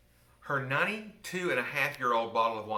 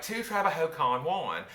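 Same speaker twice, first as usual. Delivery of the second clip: slow, loud Spanish in a Southern accent; 6 semitones higher, 5 dB louder than usual. Shown as a demonstration. Demonstrating her high-school Spanish, heavy Southern accent. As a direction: say the Spanish with a strong Southern drawl. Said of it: "probably pronounced more like".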